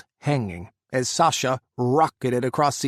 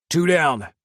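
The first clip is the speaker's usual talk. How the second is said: called out; 3 semitones higher, 6 dB louder than usual. A narrator's reading. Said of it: "Ethan called".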